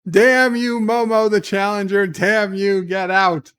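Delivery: loudly cursing Momo out; mock angry outburst. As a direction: bellow it like a melodramatic villain being defied. Reading a hostile superchat aloud.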